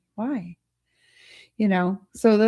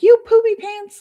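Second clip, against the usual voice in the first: high, loud mock kid taunt; a hammed-up tiny-kid jeer at a rude driver; childish insult; 12 semitones higher, 7 dB louder than usual. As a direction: squeal it like a playground name-caller. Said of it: "So I would say things like".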